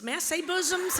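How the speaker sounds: normal talking voice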